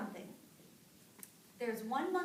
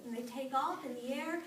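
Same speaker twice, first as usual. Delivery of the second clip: usual speaking voice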